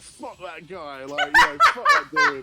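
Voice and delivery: high, loud cheering through laughter; laughing mock cheer. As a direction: imitate rowdy lads egging on. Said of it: "all cheering us on".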